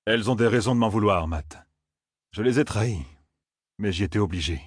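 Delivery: low, subdued confession; contrite tracker. A narrator's reading. A tracker justifies his betrayal to his victims, contrite and low.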